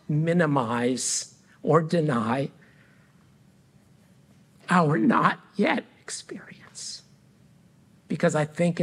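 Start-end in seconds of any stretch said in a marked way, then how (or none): 4.7-7.0 whisper. Real, not acted. Pastor asks the congregation not to deny grief, whispered. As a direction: breathe it as a hushed whisper. Emphatic close to sermon question.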